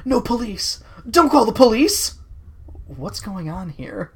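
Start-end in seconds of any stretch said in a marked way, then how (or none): 0.1-2.2 high, urgent protest; shouting. A narrator's reading. Sarah begging her rescuers not to call, shouted protest. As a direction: plead loudly, pitched right up. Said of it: "shouts Sarah".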